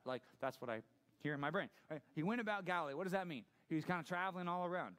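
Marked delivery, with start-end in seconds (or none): none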